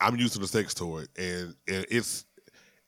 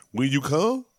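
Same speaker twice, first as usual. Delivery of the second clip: ordinary delivery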